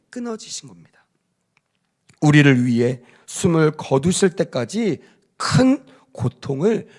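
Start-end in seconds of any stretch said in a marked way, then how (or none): 0.1-0.9 whisper. Acted. Breath ending, Jesus dies.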